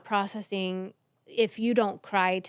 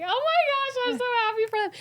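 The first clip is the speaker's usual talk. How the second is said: very high-pitched, overjoyed squeal of words; gushing happiness. Acted. Viewer watching someone get a deal, gushing happily. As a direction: gush as if overwhelmed with joy.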